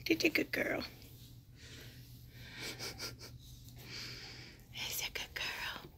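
soft whispered praise. Real, not acted. Owner whispering praise to her dog, soft whisper.

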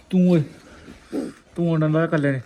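sudden loud "oye" call; loud call at a bird. Real, not acted. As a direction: raise the voice, calling out. Shouting at the bird.

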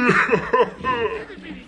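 high villainous laugh; gloating cackle. Acted. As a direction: cackle over your trapped dinner. Chief gloats over captives.

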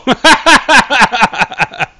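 high, loud burst of laughter; nervous laughter after a scare; loud shocked laughter. Real, not acted.

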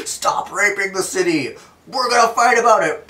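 loud, high put-on shout. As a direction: bellow it like an angry hero. Acted quarrel of the heroes.

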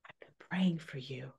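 soft, breathy whisper to a newborn; tender whisper. Retold or imitated. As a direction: whisper it lovingly, like a prayer answered. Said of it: "she probably spoke to him and said".

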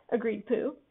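ordinary delivery, nothing marked

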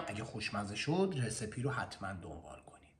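soft whispered aside. Real, not acted. Urging viewers to follow the recipe, whispered.

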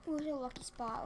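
ordinary talk

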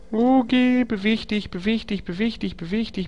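high, hushed repetitive chant; hektisches Antreiben. Real, not acted. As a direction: urge on in a quick, small, high voice. Nervously urges game character to move. (hoch und leise drängelnd wiederholen)

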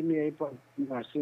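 usual speaking voice

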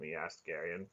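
plain reading voice

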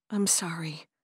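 soft breathy whispered "I'm sorry", barely voiced; whispered apology. Florence whispers her apology to Carter, hushed. Quiet apology amid tense meeting.

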